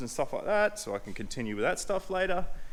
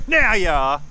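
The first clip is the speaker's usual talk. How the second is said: higher, louder put-on taunting voice; mock villain; 7 semitones higher, 10 dB louder than usual. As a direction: gloat like a cartoon baddie. Taunting parts in a voice.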